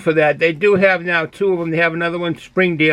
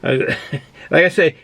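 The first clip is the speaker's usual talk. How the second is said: usual speaking voice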